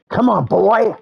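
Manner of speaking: high, loud teasing call; high cheeky challenge; high taunt. Pretend. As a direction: egg the dino on. Challenging dinosaur.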